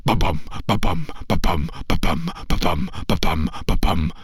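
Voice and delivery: low quick ba-bum drumbeat; deep pounding drumbeat. Acted. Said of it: "stomping around his tent even faster".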